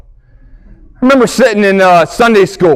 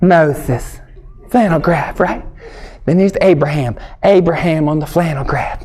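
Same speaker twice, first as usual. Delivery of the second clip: teacher voice; 5 semitones lower, 5 dB quieter than usual. Retold or imitated. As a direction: present Moses like a Sunday school teacher. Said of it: "they'd be like".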